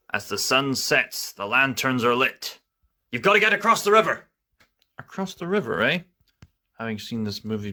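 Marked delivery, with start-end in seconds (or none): 3.1-4.3 fast urgent warning; urgent rushed. Acted. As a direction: rush the line in alarm.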